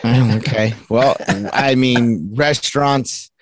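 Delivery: ordinary talk, nothing marked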